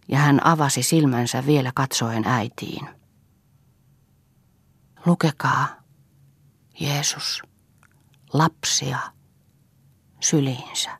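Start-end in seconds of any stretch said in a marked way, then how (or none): 5.0-10.9 halting, near-whispered request; dying words. A narrator's reading. Dying Elsa asking for a last reading, faint and halting. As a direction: break it into single faint words, long pauses between.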